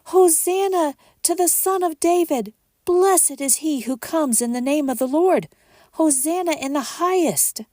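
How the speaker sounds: high, loud joyful acclamation; crowd crying out. Acted. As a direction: call it out like a jubilant crowd. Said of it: "cried out".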